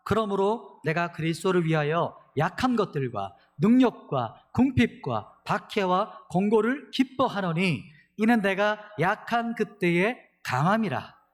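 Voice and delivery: slow, loud, declaiming recitation; proclaiming scripture. Real, not acted. Pastor leading the congregation in proclaiming the verse. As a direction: proclaim it together, slowly. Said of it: "같이 한번 선포하겠습니다".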